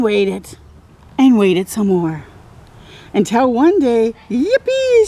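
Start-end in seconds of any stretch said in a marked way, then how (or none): 4.3-5.1 squeaky high little cheer of joy; excited cheer. Acted. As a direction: cheer like a delighted child. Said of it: "she says".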